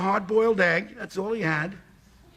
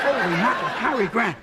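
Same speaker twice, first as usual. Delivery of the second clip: ordinary delivery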